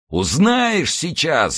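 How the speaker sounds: drawn-out, high-pitched threatening cry; menacing roar. A narrator's reading. Horsemen answering d'Artagnan in chorus, menacing roar. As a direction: roar it slowly, stretching the words. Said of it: "заревели всадники хором".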